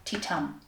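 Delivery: a quiet falling "ti-tum" beat; heartbeat rhythm. Shown as a demonstration. Teacher recapping ten sounds, soft rhythmic beat. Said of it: "ten sounds".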